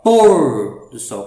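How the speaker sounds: "Pôr" loud and stretched, "do sol" falling away; stress on pôr. Shown as a demonstration. Teacher showing the tonic syllable, stressed first word. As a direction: hit "Pôr" long with a closed o. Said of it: "coloca mais força".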